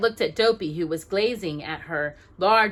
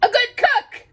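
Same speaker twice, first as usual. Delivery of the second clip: high loud eager exclamation; high loud excited dwarfs; excited cry; 9 dB louder than usual. A narrator's reading. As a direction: cry it out hungrily. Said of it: "cried the dwarfs suddenly".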